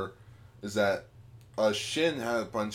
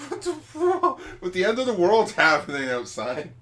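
much higher, louder disbelieving squeal; incredulous; 10 semitones higher, 7 dB louder than usual. Acted. Incredulous retort acted.

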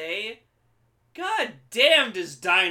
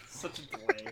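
usual speaking voice

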